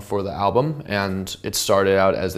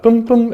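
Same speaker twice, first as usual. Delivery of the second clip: high, loud boom boom like plucked notes; imitating guitar notes; hummed bass notes; 14 semitones higher, 6 dB louder than usual. Shown as a demonstration. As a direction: sing the notes out as a punchy hum. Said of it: "uh, and the B flat".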